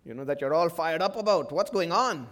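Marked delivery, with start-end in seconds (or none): none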